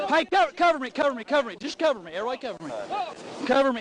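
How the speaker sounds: loud urgent call for backup; cover me shout. Real, not acted. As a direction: yell for cover over and over. Trooper calling for backup at gunpoint.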